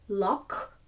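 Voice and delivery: loch with a throaty ch; Scottish loch. Shown as a demonstration. Teacher modelling the Scottish loch. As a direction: say loch as in Scots, ending in a rasp. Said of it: "like C H in the Scots".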